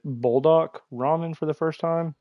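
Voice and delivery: usual speaking voice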